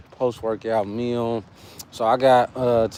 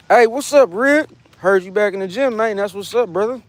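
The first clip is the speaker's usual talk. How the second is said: excited greeting; 7 semitones higher, 4 dB louder than usual. Real, not acted. Greeting a viewer in chat.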